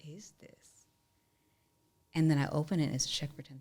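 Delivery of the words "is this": awed whisper. Retold or imitated. Holding the folded check.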